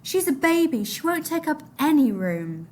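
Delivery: put-on high desperate boy; pleading boy. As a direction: beg for your baby sister. Mahmoud still begging.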